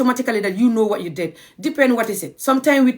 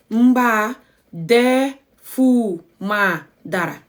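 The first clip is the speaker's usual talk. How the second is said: slow teaching demo; 2 semitones higher, much slower than usual. Shown as a demonstration. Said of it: "Mbaa is I hope".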